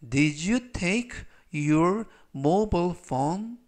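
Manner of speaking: very slow question with a rising end; slow model question. Shown as a demonstration. Teacher reads the phone question slowly to the class. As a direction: ask it slowly so students can follow. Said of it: "전체적 의미는".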